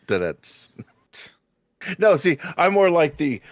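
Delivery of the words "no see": normal talking voice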